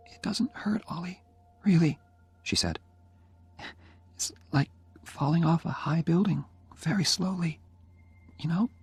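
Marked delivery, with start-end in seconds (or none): 0.1-2.0 high, whispered reassurance; dying girl whispering to comfort Oliver; gentle whisper. A narrator's reading. 4.1-8.7 put-on higher young woman's voice, wry; light, teasing young woman from her sickbed; dying girl describes how it feels, higher voice; Jenny's voice. A narrator's reading.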